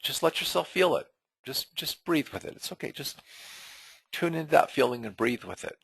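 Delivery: quiet calming reassurance; soothing therapist voice. Shown as a demonstration. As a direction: coax them to breathe through it, softly. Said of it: "I, uh, often say".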